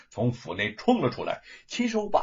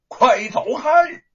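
all-out shout; 12 semitones higher, 10 dB louder, slower than usual. Acted. Gatekeeper yells louder.

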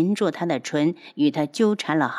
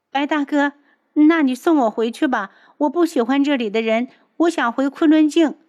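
put-on higher, louder sulky girlish voice; petulant young girl; 8 semitones higher, 5 dB louder than usual. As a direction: whine like a spoiled young girl. Liu'er's voice.

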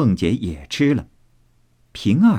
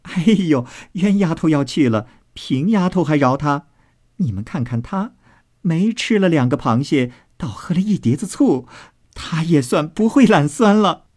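high, girlish mocking voice; teasing maid; 6 semitones higher than usual. A narrator's reading. Maid poking fun at another's jealousy, high and teasing. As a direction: taunt merrily, pitched up. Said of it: "琥珀笑道".

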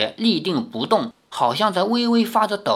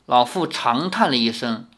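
plain reading voice

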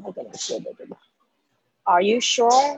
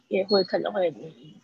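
usual speaking voice

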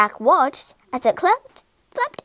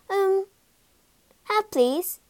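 higher, hesitant little answer; hesitant small boy; 8 semitones higher, slower than usual. A narrator's reading. Jack offered enormous sausages, hesitant polite reply. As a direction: answer politely, unsure, with a pause.

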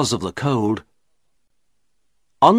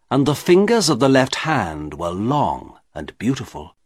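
ordinary delivery

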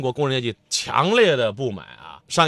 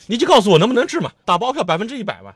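higher, louder and fast, imitating a pushy layman; mock demanding patient; 9 semitones higher, 4 dB louder, faster than usual. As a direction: mimic someone badgering the doctor for a guarantee. (高声快速地模仿医盲逼医生打包票)

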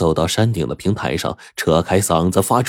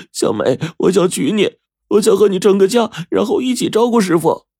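much higher, louder tearful confession; sobbing confession; 14 semitones higher, 4 dB louder than usual. Acted. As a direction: pour out his heart while weeping. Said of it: "呜呜地哭道".

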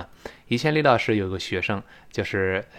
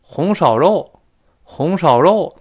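"红烧肉" with Tianjin tones, higher and louder; Tianjin dialect; 6 semitones higher, 8 dB louder, slower than usual. Shown as a demonstration. Teacher imitating Tianjin dialect. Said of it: "那天津话".

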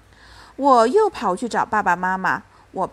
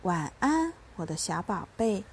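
soft bedtime goodnight; gentle and hushed, sinking lower at the end; tender; 3 semitones lower, 8 dB quieter than usual. A narrator's reading. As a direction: murmur lovingly to a little child. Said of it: "温柔地说".

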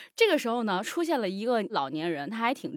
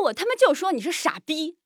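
higher, louder defiant insult; heated mock shout; retold yell; 7 semitones higher, 6 dB louder than usual. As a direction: re-enact yelling it right back at him. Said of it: "我就对着他喊".

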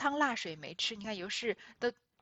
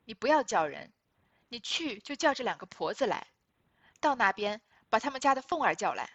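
firm commanding; 6 dB louder than usual. Acted. Youshi angrily giving orders.

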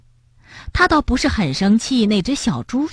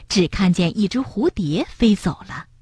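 ordinary delivery, nothing marked

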